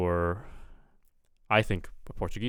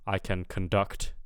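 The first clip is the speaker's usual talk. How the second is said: "conduct" stressed con-DUCT; second-syllable stress. Shown as a demonstration. Teacher giving a verb example, conduct stressed on the end. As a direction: put the weight on DUCT in "conduct". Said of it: "so that's a verb".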